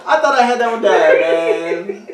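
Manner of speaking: loud, high laughing protest; laughing outrage. Real, not acted. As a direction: yell it through real laughter at the teasing. Laughing mock outrage at friend.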